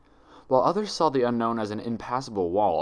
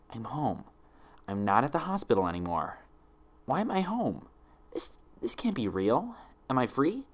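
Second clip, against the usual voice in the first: frightened; 3 semitones higher than usual. A narrator's reading. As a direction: whisper the questions to yourself. Frightened pony waking confused.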